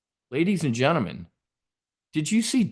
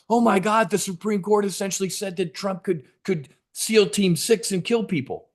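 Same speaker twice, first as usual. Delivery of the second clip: high, hysterical mock panic; a high, shrill parody of panicking Democrats; mocking panicked Democrats; 7 semitones higher than usual. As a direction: ham up their alarm. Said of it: "All the Democrats were like".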